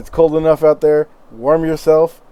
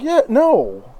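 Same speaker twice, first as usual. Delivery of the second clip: lightly pitched innkeeper; 8 semitones higher than usual. Acted.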